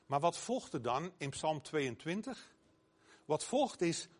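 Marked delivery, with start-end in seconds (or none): none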